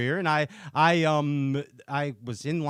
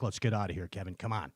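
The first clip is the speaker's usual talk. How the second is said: low, softer quoted aside; low-key urging; 9 semitones lower, 9 dB quieter, faster than usual. Retold or imitated. Companion urging Kevin to leave, low-key. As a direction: quietly nudge a friend to leave, voice dropped. Acts out Zona urging him away.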